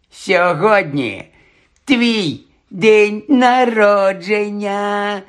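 high, very drawn-out jeer; spiteful taunt. A narrator's reading. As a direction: drag out each word to needle him. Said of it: "єхидно мовив Дадлі". (єхидно, розтягуючи слова)

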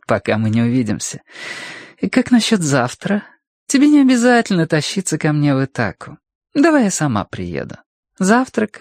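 high, coaxing female voice; alluring, insistent phone voice; flirtatious woman caller. Acted. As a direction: coax him playfully into a meeting. Sonya coaxes Gurney to meet.

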